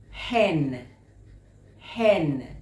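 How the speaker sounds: HEN loud, drawn out on the "eh"; overemphasized short E. Shown as a demonstration. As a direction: lean into the vowel of HEN.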